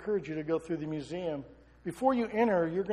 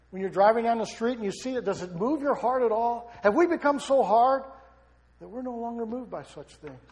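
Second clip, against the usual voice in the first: ordinary delivery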